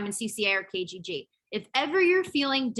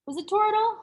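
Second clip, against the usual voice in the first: much higher, questioning Toradol; unsure question; 11 semitones higher than usual. Real, not acted. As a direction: ask it, unsure of the name. Unsure, asking about the drug name.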